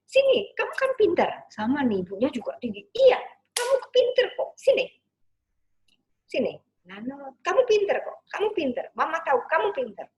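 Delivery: high, loud insistent mother; loud high-pitched mother keeping the words positive; high, intense mother. Shown as a demonstration. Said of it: "dengan tekanan dan intonasi yang tinggi".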